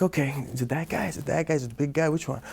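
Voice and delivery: quietly sizing up who he must fight; muttered to himself. Retold or imitated. Softly asking himself before a sparring match, hushed. Sizing up opponents, inner whisper.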